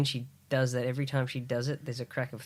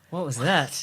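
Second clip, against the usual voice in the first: higher, louder laughing question; baffled laugh; 7 semitones higher, 7 dB louder than usual. Real, not acted. Laughing at the ending with friend. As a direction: laugh in disbelief.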